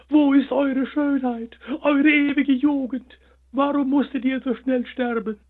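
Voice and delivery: weeping over the withered flowers; tearful lament. A narrator's reading. The hare mourns the dead sunflowers, high and tearful. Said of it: "rief er verzweifelt und wischte sich ... die Tränen".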